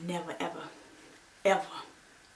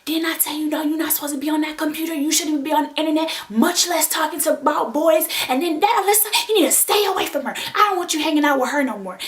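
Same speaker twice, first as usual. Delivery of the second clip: raised, insistent telling-off; high-pitched retold lecture, building in volume; scolding mom; 7 semitones higher, 4 dB louder than usual. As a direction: act out a mother laying down the law. Mom's scolding lecture acted out.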